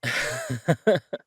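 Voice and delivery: short, loud, high laugh; burst of laughter. Acted. Chef talking dream restaurants with partner, burst of laughter.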